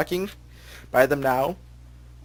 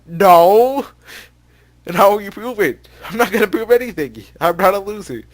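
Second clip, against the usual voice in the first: loud indignant shout; loud protest; 7 semitones higher, 10 dB louder than usual. Acted. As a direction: yell it back in protest. Heated quarrel read aloud.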